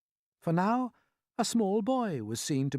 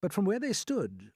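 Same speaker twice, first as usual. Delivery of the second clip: usual speaking voice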